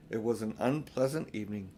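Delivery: ordinary delivery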